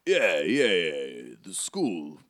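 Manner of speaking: hesitant character voice; bumbling, halting storybook helper; Hagrid. Acted. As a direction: fumble for the word in a put-on accent.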